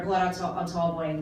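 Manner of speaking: ordinary talk, nothing marked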